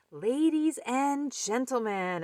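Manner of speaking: high, ringing ringmaster opening; announcer. Acted. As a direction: announce to the whole audience. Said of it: "announced the rabbit".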